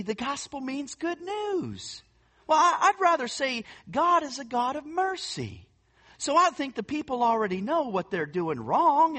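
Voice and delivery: light imitation of a Texas accent; Texan accent. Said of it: "it's hard not to do this without the accent".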